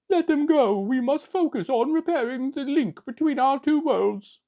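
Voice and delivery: very high, loud robot drone; high robot voice ordering the Decepticons; Shockwave voice. Retold or imitated. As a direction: give the order like a cartoon robot. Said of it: "a kind of a funny voice in the cartoon".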